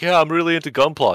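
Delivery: put-on a nerd gushing over Gunpla to the girlfriend; eager nerd. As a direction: play the keen hobby nerd showing off. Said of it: "the chubby nerd is like".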